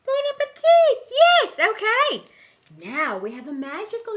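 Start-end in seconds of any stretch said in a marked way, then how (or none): 0.1-2.2 high, loud "bon appetit" cheer; exuberant call. Acted. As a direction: sing out to the whole room. Cheerily echoes Jacques' bon appetit.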